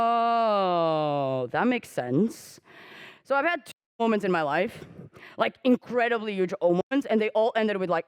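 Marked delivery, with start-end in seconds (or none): 0.0-2.6 dawning realization. Retold or imitated. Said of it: "you're like".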